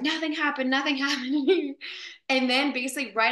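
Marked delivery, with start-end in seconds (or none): 0.0-1.8 higher-pitched, comic protesting innocence; high mock-defensive denial; mock hasty denial. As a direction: insist on innocence with a laugh creeping in. Retold denial after suspicious trailer incident.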